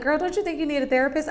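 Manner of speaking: high, fast friend voice; quickly, brightly voiced friend; friend's voice. Friend pushing her toward therapy, fast and high. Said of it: "My friend was like".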